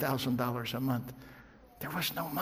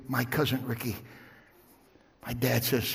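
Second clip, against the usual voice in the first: ordinary delivery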